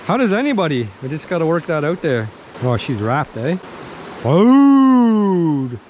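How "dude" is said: loud high yelled exclamation; excited shout. Real, not acted. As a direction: shout it out in triumph. Second catch.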